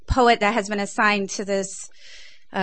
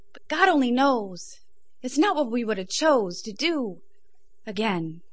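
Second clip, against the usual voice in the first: rhythmic, intense poetry performance; spoken word poem; 4 semitones higher than usual. Acted. Poet performing Us and Them at the showcase, spoken word delivery.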